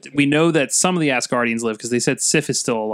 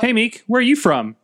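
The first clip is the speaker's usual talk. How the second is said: raised quoting voice; 10 semitones higher than usual. Retold or imitated. Acted quote, high.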